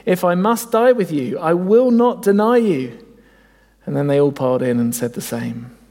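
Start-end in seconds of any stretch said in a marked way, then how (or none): 0.0-3.0 higher and louder, insisting he will not deny; Peter's fierce vow, pushed up in pitch; a hint of an indignant disciple protesting loyalty; emphatic protest. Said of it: "He said emphatically".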